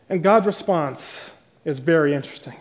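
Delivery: ordinary talk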